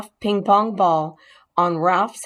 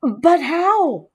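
much higher, stunned; can't believe it happened; shocked question; 10 semitones higher, 3 dB louder than usual. Acted. Boy demanding how, in mild shock.

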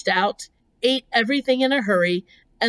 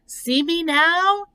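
high, slow, rising question; lilting storybook spider; friendly spider voice; 10 semitones higher, 3 dB louder, slower than usual. A narrator's reading. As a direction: ask sweetly in a lifted creature voice. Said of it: "in a friendly greeting".

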